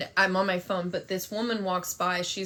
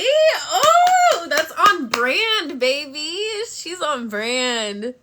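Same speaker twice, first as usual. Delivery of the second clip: much higher, louder, stretched out; delighted squeal; 7 semitones higher, 8 dB louder, much slower than usual. Real, not acted. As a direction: gush over the gift, dragging on brand. Delighted reaction to gift.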